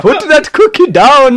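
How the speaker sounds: slow, loud mock Arnie yell; imitating a bad Arnie; Schwarzenegger impression. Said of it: "It was pretty bad".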